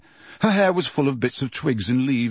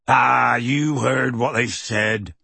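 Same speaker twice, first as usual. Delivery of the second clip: dismissive; slower than usual. Acted. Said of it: "said Hagrid dismissively".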